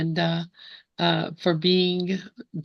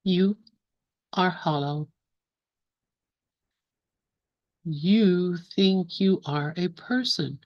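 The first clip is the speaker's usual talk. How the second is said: slow, measured recital with long pauses between lines; slow poem reading. Real, not acted. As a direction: read each line slowly, leave room to contemplate. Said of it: "gonna read the poem slowly".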